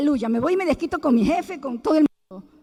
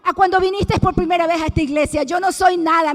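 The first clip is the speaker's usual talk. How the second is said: louder, impassioned sermon voice; rising preacher's fervour; fervent preaching; 3 semitones higher, 9 dB louder than usual. Acted. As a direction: preach it to the congregation. Preaching to the congregation.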